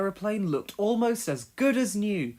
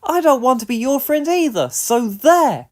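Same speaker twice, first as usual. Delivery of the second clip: high, loud indignant mouse; spluttering retort; 8 semitones higher, 11 dB louder than usual. A narrator's reading. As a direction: huff it back, offended. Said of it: "he spluttered".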